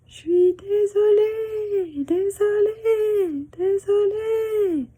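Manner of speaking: high, slow apology fading away; echo. Acted. Mountains echoing back the apology. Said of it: "echo of her words".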